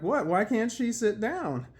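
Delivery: mock bewildered onlookers; retold indignant surprise. Onlookers asking why she can't sit, retold indignant surprise.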